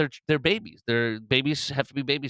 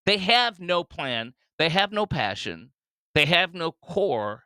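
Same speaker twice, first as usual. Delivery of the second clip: higher and slow, each line hammered out; slow emphatic list; 7 semitones higher, slower than usual. Real, not acted. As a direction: spell out every "no" slowly, like a verdict. Ranting against Democrats.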